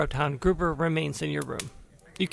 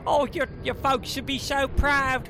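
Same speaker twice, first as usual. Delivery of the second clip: pixie; 8 semitones higher than usual. Acted. Gnome cousin greets Winley.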